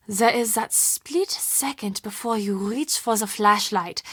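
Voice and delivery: ordinary talk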